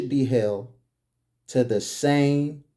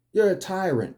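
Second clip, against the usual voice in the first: ordinary talk, nothing marked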